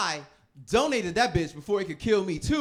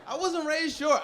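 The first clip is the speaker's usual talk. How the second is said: "I wasn't raised short" jumped up high and indignant; higher indignant repeat; 9 semitones higher than usual. Shown as a demonstration. Repeating his point to the crowd in a higher indignant voice. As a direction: insist it again, higher.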